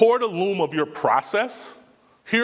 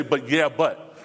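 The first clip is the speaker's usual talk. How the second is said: mimicking a skeptic; slower than usual. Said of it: "a lot of "yeah, but".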